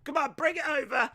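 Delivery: high, hammed-up shout across a room; pretend calling an assistant. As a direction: order an imaginary helper about.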